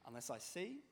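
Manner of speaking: usual speaking voice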